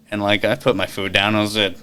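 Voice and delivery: ordinary talk, nothing marked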